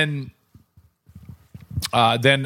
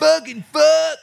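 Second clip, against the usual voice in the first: high, loud mock yell at the booth; heckler yell; 5 dB louder, slower than usual. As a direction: hurl it up like a drunk heckler. Retold heckler cursing the announcer.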